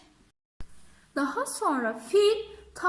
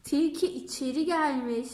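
plain reading voice

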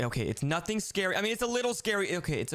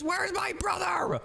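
calling out; 11 semitones higher, slower than usual. Pretend. Calling for missing brother.

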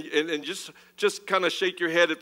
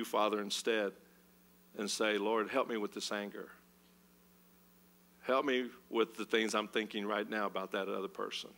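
much softer and lower, laying it down; humble quiet prayer; 8 semitones lower, 10 dB quieter than usual. Acted. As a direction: hush yourself and surrender the anger. Acted humble prayer after anger.

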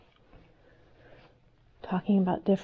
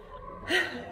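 short laugh; 8 semitones higher, 5 dB quieter than usual. Real, not acted. Laughs about the dark stairs.

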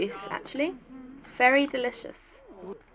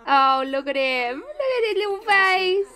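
cooing that leaps up high; adoring cooing; 7 semitones higher than usual. Real, not acted. Cooing over little William.

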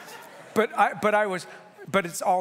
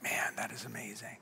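awed whisper; 7 semitones lower, 11 dB quieter than usual. Retold or imitated. Awed at rescue video.

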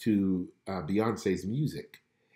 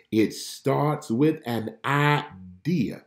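very slow, every word weighed; slow emphatic; 3 semitones higher, much slower than usual. Shown as a demonstration. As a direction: drag it out to drive the point home.

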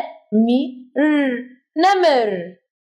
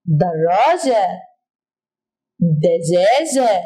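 "دراجة" and "دجاجة" drawn out slowly and lower; slow model words; 6 semitones lower, slower than usual. Shown as a demonstration. Teacher models example words for the children, slowly. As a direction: stretch out "دراجة" and "دجاجة" syllable by syllable. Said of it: "مثل كلمة إيه؟".

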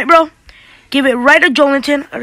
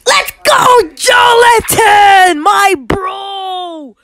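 real high celebratory shout, drawn out; excited shout; 6 semitones higher, much slower than usual. Real, not acted. Player cheering Jolinton's goal in a game, excited shout. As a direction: stretch the name out in a high victory yell.

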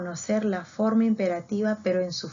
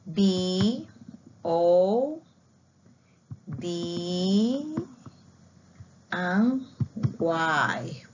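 spelled letters; much slower than usual. Shown as a demonstration.

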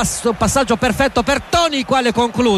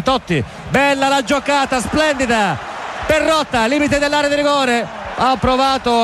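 excited commentary; 4 semitones higher than usual. Real, not acted. Fine attacking move.

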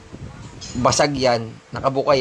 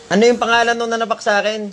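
loud, high call across the room; 10 semitones higher, 4 dB louder, faster than usual. Real, not acted. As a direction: shout the question to someone away from the mic. Calling to someone off-mic. (malakas na pagtawag)

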